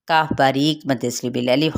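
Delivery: normal talking voice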